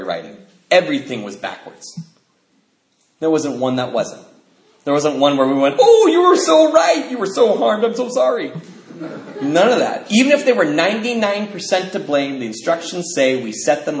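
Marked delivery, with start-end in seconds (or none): 5.8-8.5 high, gushing fake commiseration; mock sympathy. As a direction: pour on the pity, pitched way up. Mock sympathy that never happened.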